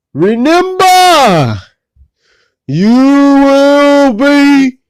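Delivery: very slow, high, loud drawn-out warning; stern dad warning. Retold or imitated. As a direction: stretch it out like a stern father. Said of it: "Dad came up to him and said".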